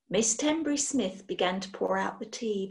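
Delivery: ordinary delivery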